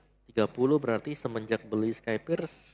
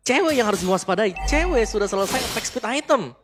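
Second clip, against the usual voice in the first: excited caster; 7 semitones higher, 3 dB louder than usual. Acted. Caster hyped analysis.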